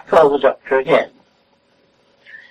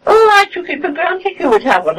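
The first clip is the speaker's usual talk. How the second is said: higher, lightly mimicked surprise; mock surprised friend; 11 semitones higher than usual. The friend assuming there was a thermometer, mimicked higher in mild surprise. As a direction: imitate her taken-aback reply.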